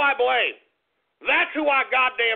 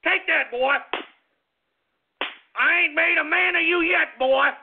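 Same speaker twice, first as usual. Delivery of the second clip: higher-pitched threatening country father; angry daddy; 5 semitones higher than usual. Pretend. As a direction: threaten the boy like an irate daddy. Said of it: "if I was your daddy".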